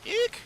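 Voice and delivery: high, sharp little squeak of surprise; startled squeak. Real, not acted. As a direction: squeal as the feedback hits. Startled by feedback noise.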